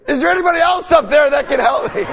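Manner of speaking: high, loud panicked shout upward; desperate yell for help. Retold or imitated. Man on the cliff, turning down God, shouting high for anyone else. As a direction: yell up, begging for someone else. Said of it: "all of a sudden you hear".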